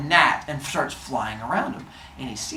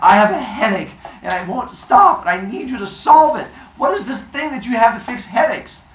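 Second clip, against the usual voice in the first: high and loud frantic plea; frantic pleading; 8 semitones higher, 8 dB louder than usual. Retold or imitated. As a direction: push every phrase out loudly. Zeus pleading with Hephaestus.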